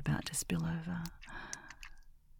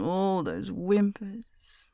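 very slow, voiced and lingering on "all those whimpers"; drawn-out echo; 5 semitones higher, much slower than usual. Miss repeating it back to her pet, slow and savouring.

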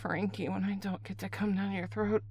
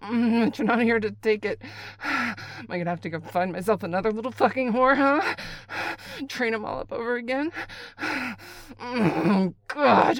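louder and higher, rushing; building frenzy; 4 semitones higher, 8 dB louder than usual. Acted. Caller working herself up over the absent partner, rising frenzy. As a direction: build it up loud and fast.

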